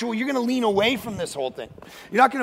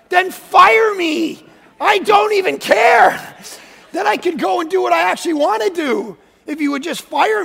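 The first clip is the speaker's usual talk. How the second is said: mock shout; 10 semitones higher, 8 dB louder than usual. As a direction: yell it like you're daring them. Retold angry outburst at church.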